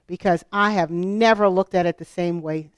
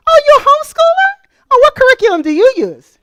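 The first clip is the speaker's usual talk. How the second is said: shrill, much higher, louder eager parent voice, fast; mock an overeager stranger quizzing you; gushing homeschool mom; 10 dB louder, faster than usual. Speaker mimicking convention strangers who ask about curriculum, gushing high voice.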